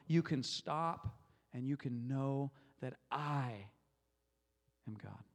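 slow, intimate, with "I" held out before a long pause; tender personal address with weight on I; God speaking personally. Acted. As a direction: linger on "I", then sink to a low hush. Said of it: "That's God speaking to me".

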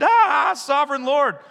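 high, loud terrified cry; exclaimed shout. Acted. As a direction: cry out in awe and fear. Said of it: "he exclaimed".

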